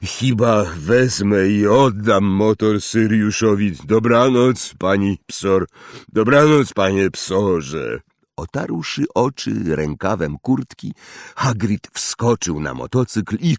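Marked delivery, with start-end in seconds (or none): 0.0-8.0 half-giant speaking through a hanky; muffled giant voice. A narrator's reading. Hagrid voiced, still muffled.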